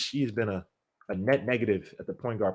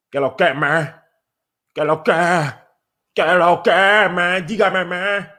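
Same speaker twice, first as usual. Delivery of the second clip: loud, high Dominican street greeting; Dominican accent; 8 semitones higher, 11 dB louder, slower than usual. Pretend. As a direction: hype Dominican slang called out to a viewer. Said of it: "That's Dominican".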